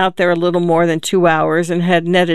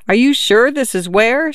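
mild, higher-pitched narrated yell; restrained yell with a questioning lift; yelled back; 6 semitones higher than usual. A narrator's reading. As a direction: call back doubtfully, pitch raised. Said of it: "she yelled back at the man".

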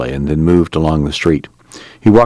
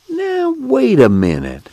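much higher, drawn-out pleading; slow down, coax her back; slower than usual. Acted. Al calming the angry girl.

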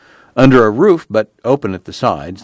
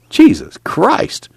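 high, slow, drawn-out amazement; astonished exclamation; 11 semitones higher, much slower than usual. A narrator's reading. Newcomer hearing of camp dances, astonished. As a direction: stretch it out in wonder.

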